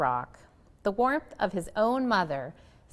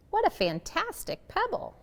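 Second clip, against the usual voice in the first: father delighted by a pebble; admiring; 12 semitones higher than usual. A narrator's reading.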